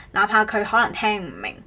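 ordinary talk

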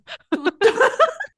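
squealing laugh; high burst of laughter. Real, not acted. Laughing at joke.

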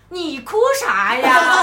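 大声、放慢地笑着喊"你哭啥呀"; 隔着人群逗她，拖长着喊; 远远喊话. Retold or imitated. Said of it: "凯子还在遥遥的cue我说". (学凯子远远大声打趣)

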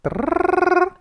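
trilled 嘚儿 with the tongue tip, very high; rolled r. Shown as a demonstration. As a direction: roll the r in 嘚儿 as a quick tongue trill. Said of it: "[name]就可以发出弹舌音". (弹舌音嘚儿)

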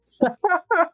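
a sudden, high laugh; high burst of real laughter. Real, not acted. Laughing at boss's late meeting.

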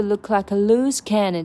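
"look like a loose cannon" slowed right down, every L crisp; slow clear l sounds. Shown as a demonstration. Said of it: "L L".

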